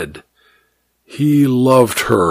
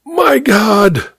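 choked gasp; 9 semitones higher than usual. A narrator's reading. Man seized and smothered.

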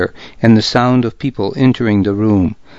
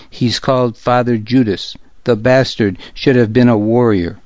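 plain reading voice

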